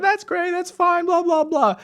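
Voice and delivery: high mock grandma brushing him off; imitating grandma. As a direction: squeak it out like a sweet old grandma. Grandmother on phone, quoted.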